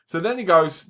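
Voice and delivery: normal talking voice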